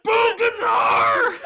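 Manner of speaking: drawn-out yell through laughter; laughing shout. Acted. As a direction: howl the name, barely holding a laugh. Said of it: "hear someone say Boongazar with so much emotion".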